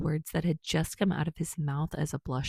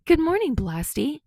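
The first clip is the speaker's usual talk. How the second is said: much higher, louder sing-song hello; cheerful greeting; 10 semitones higher, 6 dB louder than usual. Acted. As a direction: greet him with a big excited call. Kirishima greets Bakugo cheerfully.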